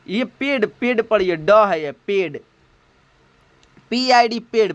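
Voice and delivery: normal talking voice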